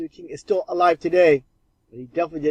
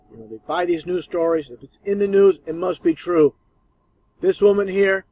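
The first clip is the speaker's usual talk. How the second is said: ordinary talk, nothing marked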